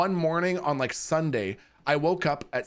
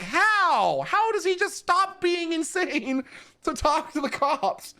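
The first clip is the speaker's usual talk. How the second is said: higher, incredulous mock question; retold disbelief; 14 semitones higher, slower than usual. Streamer retells his own amazement at the neighbor, mock incredulous. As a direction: act amazed at the sudden switch. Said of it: "And I was like".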